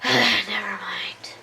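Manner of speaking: whispered sigh. Real, not acted. Gives up mid-sentence.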